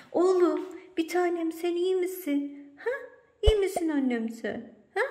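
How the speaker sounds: high-pitched cooing to a beloved pet; doting pet talk. Real, not acted. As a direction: fuss over your pet in a high voice. Fussing tenderly over quiet pet.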